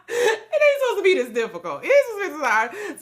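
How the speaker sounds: very high, laughing complaint; laughing protest. Retold or imitated. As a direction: protest through laughter, pitch way up. Laughs repeating the dream complaint.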